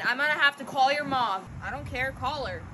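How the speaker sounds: normal talking voice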